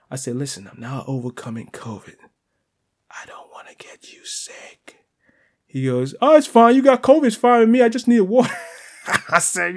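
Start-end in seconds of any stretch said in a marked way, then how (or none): 0.4-5.0 hushed warning fading into a whisper; under his breath. Retold or imitated. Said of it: "I said it under my breath". 6.2-8.5 high, loud retold shout. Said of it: "he shouted loud".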